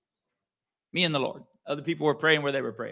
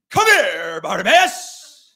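loud, drawn-out, overblown call; mock dramatic Jesus; 3 semitones higher, 10 dB louder, much slower than usual. Preacher mocking a theatrical Jesus calling Bartimaeus out loud. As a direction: stretch Bartimaeus long and loud. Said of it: "It's silly, isn't it?".

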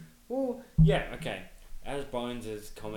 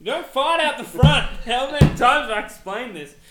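loud high mock scolding; mock exasperated yell; 13 semitones higher, 17 dB louder than usual. Friend mock-scolding a friend about farting, loud exasperated yell. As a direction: yell it like a fed-up teacher.

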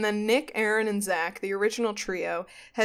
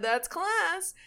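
high, softer echo of the quote; mock quote, pitched up and held back; quoted repeat; 8 semitones higher, 5 dB quieter than usual. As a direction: repeat it high without shouting. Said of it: "He said".

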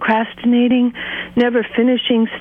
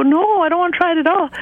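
lightly voiced kid, higher and quick; a quick, higher-pitched hint of a child's refusal; little kid refusing; 6 semitones higher, faster than usual. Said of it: "Or sometimes they go".

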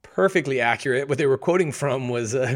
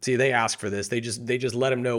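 ordinary delivery

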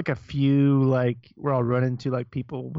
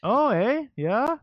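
put-on higher, lilting Fargo accent with questioning rises; 4 semitones higher, much slower than usual. Podcaster recalling that movie, mock Fargo accent. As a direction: imitate the Midwestern Fargo accent. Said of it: "Fargo accents in that movie".

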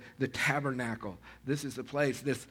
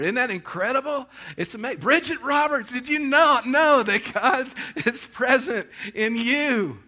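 much higher and louder, near shouting with laughter; an overjoyed pastor singling out his flock; ecstatic calling out; 10 semitones higher, 8 dB louder than usual. Real, not acted. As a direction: call each name out in joyful disbelief. Calling out congregants by name.

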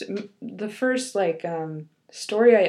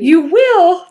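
happy; 8 semitones higher, 10 dB louder than usual. A narrator's reading. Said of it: "the ogre said happily".

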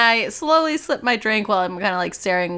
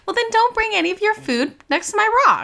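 snippy; 13 semitones higher than usual. Acted. Quarrel with bartender.

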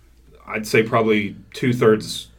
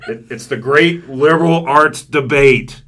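hype announcer; 5 semitones higher, 7 dB louder, slower than usual. Acted. Announcing the big debate.

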